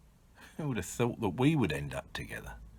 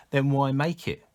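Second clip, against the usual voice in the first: higher, louder challenge; challenging retort; 5 semitones higher, 7 dB louder than usual. Acted. Victim challenges speaker's tactless comment, sharp retort. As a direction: throw the question back, raised and forceful. Acted retold challenge in argument.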